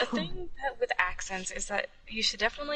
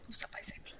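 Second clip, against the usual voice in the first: whisper; 11 dB quieter, faster than usual. Real, not acted. Greeting repeated as a whisper.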